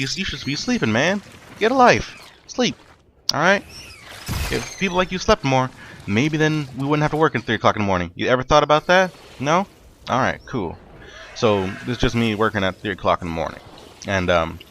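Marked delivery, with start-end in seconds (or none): 0.0-10.8 high-pitched, exasperated telling-off; mock scolding. Telling off people who want stuff at three, mock scolding. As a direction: lecture them in an incredulous high voice.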